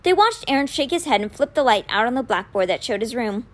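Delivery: ordinary delivery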